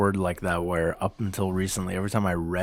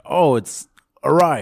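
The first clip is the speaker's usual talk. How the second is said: sudden realization; 7 semitones higher, 8 dB louder, slower than usual. Retold or imitated. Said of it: "I was like".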